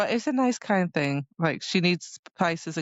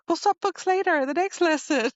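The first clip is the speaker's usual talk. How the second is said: high, bright chirpy promise; cheerful plan; 12 semitones higher than usual. Retold or imitated. The friends planning a book swap, high and chirpy. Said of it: "they're like".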